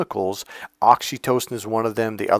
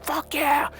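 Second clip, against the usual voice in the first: pumped-up; 4 dB quieter than usual. Acted. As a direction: cheer as if flooded with adrenaline. Athlete's adrenaline rush acted out.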